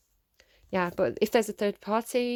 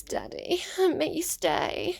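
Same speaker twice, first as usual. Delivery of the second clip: put-on high breathy little-girl voice, much higher; baby voice; 10 semitones higher, slower than usual. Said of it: "use my little baby voice".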